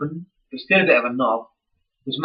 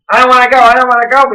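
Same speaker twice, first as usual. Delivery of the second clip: whiny reluctant fan; 8 semitones higher, 9 dB louder, faster than usual. Retold or imitated. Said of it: "we were like".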